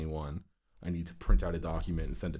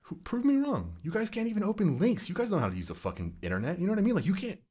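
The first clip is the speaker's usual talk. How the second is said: exasperated rant; 11 semitones higher than usual. Real, not acted. Venting to friends about the dealership, high and exasperated.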